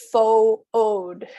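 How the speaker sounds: fo-od broken into two syllables, fo then od; two-syllable food. Shown as a demonstration. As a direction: split fo-od into two beats like a learner. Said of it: "with two syllables".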